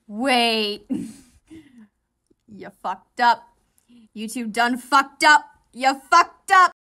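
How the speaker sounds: loud, laughing yell; shouting. Real, not acted. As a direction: holler it at the screen. Yelling at the characters.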